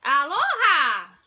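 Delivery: high, loud, slow alien greeting; stretched, booming Stitch welcome; loud exclaimed aloha. Acted. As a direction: belt out a long aloha. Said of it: "Stitch exclaimed".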